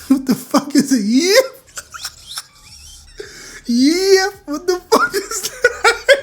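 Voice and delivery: laughing so hard it cracks. Real, not acted. Laughing at tweet.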